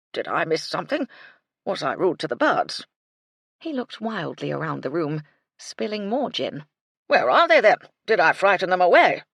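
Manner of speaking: lightly voiced muddled old colonel; befuddled colonel. A narrator's reading. Colonel baffled about the birds, loud and muddled.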